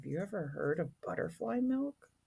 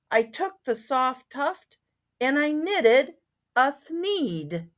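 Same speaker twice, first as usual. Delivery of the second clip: expressive storybook reading; story narration; 10 semitones higher, 12 dB louder, slower than usual. A narrator's reading. Once-ler telling how he built his shop, expressive storybook narration. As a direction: read aloud with rhyming lilt.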